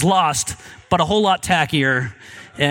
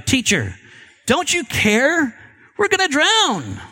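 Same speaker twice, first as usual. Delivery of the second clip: high-pitched cry without real volume; panicked plea, only half shouted; mild narrated shout; 7 semitones higher, slower than usual. A narrator's reading. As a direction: call out in mock alarm, pitch leaping up.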